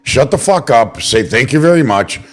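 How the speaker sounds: a real fed-up outburst; exasperated rant. Real, not acted. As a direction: vent the frustration, climbing to a yell. Exasperated rant about the deal.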